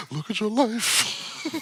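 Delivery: high, drawn-out mock life-coach plea; Iyanla. Friend clowning as a TV life coach, exaggerated impression. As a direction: ham up a TV guru's urging, slowly. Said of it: "Doctor Phil and Iyanla".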